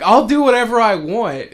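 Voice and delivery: shouted high and loud, a bully cop; mock cop shout. Retelling a cartoon cop, loud mock shout. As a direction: bark it like a smug bully cop. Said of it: "the cop is like".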